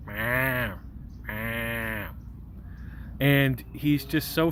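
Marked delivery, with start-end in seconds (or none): 0.1-2.1 human-sounding Meow, drawn out; bad cat impression. Shown as a demonstration. Mimicking the cat's meows, bad human impression. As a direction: fake a cat badly, Meow. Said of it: "like a person doing a bad impression of a cat".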